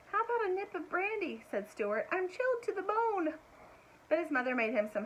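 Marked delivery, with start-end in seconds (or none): 0.1-3.3 high little mouse voice; small mouse voice. A narrator's reading. Stuart asking his mother for brandy, high mouse voice. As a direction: pipe up like a chilled little mouse.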